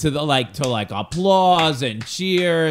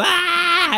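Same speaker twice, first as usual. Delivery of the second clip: high cheering shout; crowd cheer; 5 dB louder than usual. Retold or imitated. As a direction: crowd erupting. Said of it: "And the people go".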